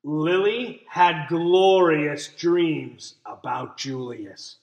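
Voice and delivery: usual speaking voice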